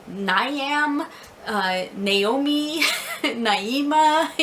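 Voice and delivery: Niam, Naomi, Naima offered as mistaken names, rising; American misreadings. Retold or imitated. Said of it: "most people in America call me".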